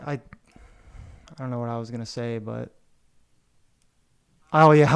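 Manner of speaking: ordinary delivery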